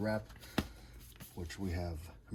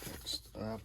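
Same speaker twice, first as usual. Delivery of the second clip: ordinary talk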